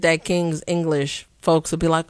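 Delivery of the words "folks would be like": ordinary talk, nothing marked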